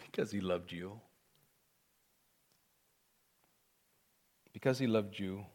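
low, very soft and tender; hushed. Real, not acted. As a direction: let it drop almost to a murmur. Preacher's hushed answer to own question.

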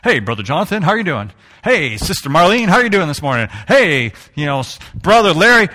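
imitating churchy greeting. Pastor mimics church folk greeting each other, lightly acted. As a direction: play the friendly churchgoer hailing each member. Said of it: "everything's brother and sister".

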